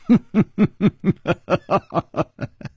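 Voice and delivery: sharp, cold villain laugh; harsh laugh. Acted. 尹姬 answers 楚留香 with a harsh cold laugh. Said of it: "尹姬厉声笑了".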